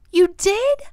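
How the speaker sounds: high, loud, delighted question; amazed. A narrator's reading. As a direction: react like an astonished child. Child amazed at father's answer.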